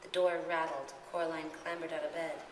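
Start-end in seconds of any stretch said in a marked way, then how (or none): none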